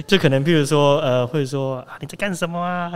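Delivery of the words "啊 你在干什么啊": put-on lightly raised cooing voice to a baby animal; baby talk. Shown as a demonstration. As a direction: softly coo the question, a hint of baby voice. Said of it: "音调会改变一点".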